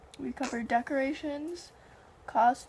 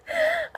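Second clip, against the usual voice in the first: sudden high laugh; high burst of laughter; 6 dB louder than usual. Real, not acted. Laughing at clown memory.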